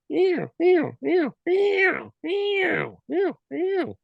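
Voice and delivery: very high, rapid elk cow chirps; cow elk mews. Retold or imitated. Hunter imitating cows chattering in normal herd talk, very high chirps. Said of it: "all the cows are gonna be".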